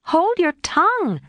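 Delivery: sharp high cry. Acted. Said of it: "cried Jo".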